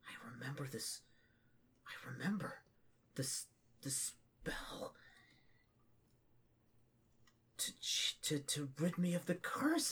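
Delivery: soft, whispery, broken phrases; hushed, faltering remembrance; halting emotional recollection. Acted. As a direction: struggle to get the words out quietly. Weeping orc recalls a spell.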